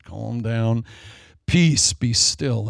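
slow, calm "Peace, be still"; calm command. Acted. Jesus calming the storm.

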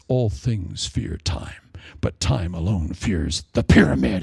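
slow, low, whispered announcer; dramatic narrator voice. Retold or imitated. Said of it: "and the voice says".

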